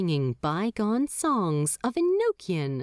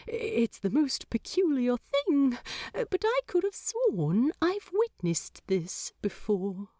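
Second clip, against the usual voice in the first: a touch higher, wondering angel; lightly voiced angel, puzzled; 6 semitones higher than usual. A narrator's reading. Aziraphale telling the demon of déjà vu, lightly voiced.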